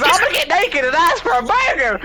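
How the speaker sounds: high squawking parrot repeating. Retold or imitated. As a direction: blurt it out like a mimicking parrot. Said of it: "the fucking parrot just goes".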